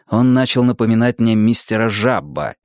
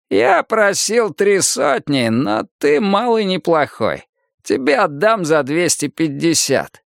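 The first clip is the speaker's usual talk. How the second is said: put-on higher, drawn-out old seller's voice; хитрый старик-продавец; 6 semitones higher, slower than usual. A narrator's reading. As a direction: haggle slowly as a sly old man, pitched up. (голос хитрого старика, высоко и неторопливо)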